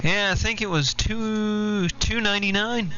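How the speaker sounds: ordinary talk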